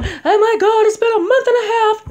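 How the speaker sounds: high, loud panicked wail; hammed-up freak-out, high and loud; mock panic. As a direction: play the frantic client losing it. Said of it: "You can't be going".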